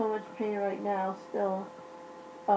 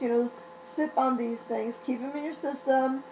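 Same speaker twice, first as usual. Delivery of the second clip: normal talking voice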